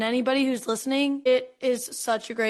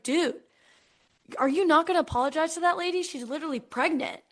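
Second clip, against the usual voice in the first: higher and fast, fed-up telling off; indignant scolding; 7 semitones higher, faster than usual. Retold or imitated. Crowd scolding a man who fell on a pregnant lady, indignant. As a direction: scold him for not apologising.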